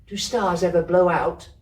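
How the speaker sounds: put-on high, wondering child voice; child's question. Kezia asking the storeman about the stars, high and wondering. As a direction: ask it like a sleepy little girl. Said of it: "she asked".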